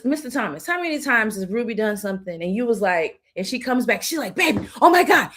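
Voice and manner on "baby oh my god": high, loud gush of excitement; gushing excited friend. Retold or imitated. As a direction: blurt it out like you can't hold it in. Friend excitedly sharing news.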